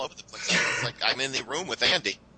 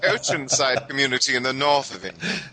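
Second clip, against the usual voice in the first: laughing through it in a high voice; high, loud delighted exclamation, easing down; amused British; 7 semitones higher, 6 dB louder than usual. Pretend. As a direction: laugh while playing along as a Brit. British character reacts, laughing, to place.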